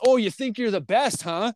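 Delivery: high, sneering challenge from the big guy; imitating the wrestler's jeer; mocking taunt. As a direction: taunt him like a bully. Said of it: "he goes".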